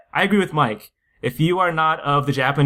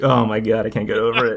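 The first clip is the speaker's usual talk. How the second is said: ordinary delivery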